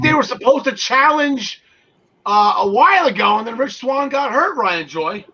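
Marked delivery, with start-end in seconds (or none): none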